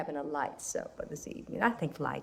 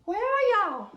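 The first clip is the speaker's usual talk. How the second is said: high call from another room; 10 semitones higher, 4 dB louder than usual. Acted. Mother calling her son from indoors, high and loud. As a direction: call him from inside the house.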